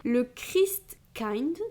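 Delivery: « Christkind » très lentement, à l'allemande; détacher « Christkind » syllabe par syllabe; prononciation allemande. Shown as a demonstration. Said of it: "excusez-moi". (prononcer « Christkind » très lentement, avec un accent allemand)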